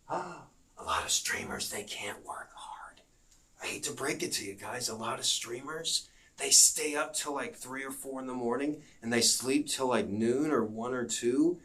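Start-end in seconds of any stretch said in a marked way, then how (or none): 0.1-7.3 mock whisper. Confiding a secret to viewers.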